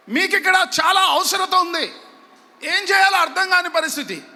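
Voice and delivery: high, urgent preacher's delivery; forceful preaching. Real, not acted. Preacher telling congregation of Mary's warning, forceful preaching.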